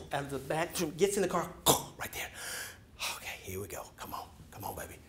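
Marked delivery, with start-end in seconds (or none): 3.2-5.0 soft whispered come on; hushed pleading whisper; whispered coaxing. Retold or imitated. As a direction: coax the car in a whisper. Coaxing the car silently.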